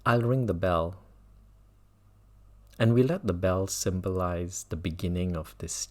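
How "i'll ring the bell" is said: normal talking voice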